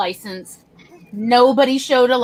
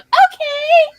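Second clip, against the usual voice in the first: put-on much higher, loud sweet kid voice; wide-eyed innocent kids; 7 dB louder than usual. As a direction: play the innocent child at the border. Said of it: "wide-eyed and bushy-tailed".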